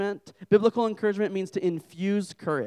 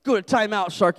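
raised, loud imitation of a child's order; mock-stern little boy, louder; scolding toddler; 5 semitones higher, 7 dB louder than usual. Son in the corner banishing his shark, loud scolding. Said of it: "went over to the corner and was like".

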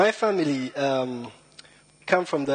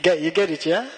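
ordinary delivery

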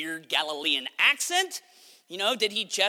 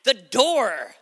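loud, higher, drawn-out exclamation; exclaiming; 5 semitones higher, 9 dB louder, slower than usual. A narrator's reading. Servant girl telling the praying believers, exclaiming. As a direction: exclaim it big and slow, as a narrator.

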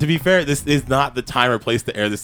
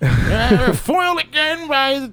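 hammed-up villain lament, stretched out; eighties villain; 12 semitones higher, much slower than usual. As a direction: wail it like a foiled cartoon baddie. Mocking him as eighties villain.

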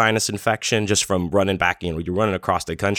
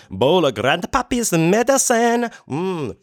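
squeaky mock old-timer; 12 semitones higher, 3 dB louder, slower than usual. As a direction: voice jumps way up on Grandpappy's medicine. Mock folksy announcing his bowl.

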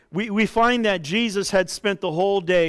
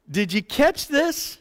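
ordinary talk, nothing marked

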